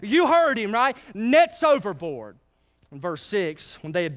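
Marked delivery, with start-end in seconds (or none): 0.0-2.4 high, raised call to a crew, then slowing on the last order; shouting orders. Acted. Peter to the men in the boat, shouting orders. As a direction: call out commands like a boat's skipper. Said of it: "you gotta hear him shouting the orders".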